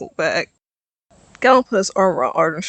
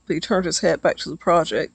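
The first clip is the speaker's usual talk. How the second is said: ordinary delivery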